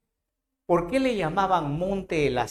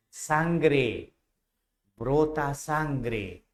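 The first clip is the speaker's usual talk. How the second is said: slow dramatic emphasis; much slower than usual. Shown as a demonstration. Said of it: "dramatic slow repeat".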